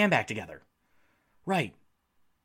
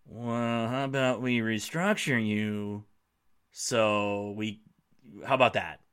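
lower, softer and slow, a smarmy team executive; mock smug front office; 10 semitones lower, 8 dB quieter, slower than usual. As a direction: lay the offer on with fake sweetness. Packers mocked offering restructure.

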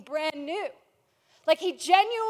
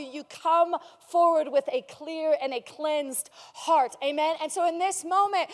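ordinary talk

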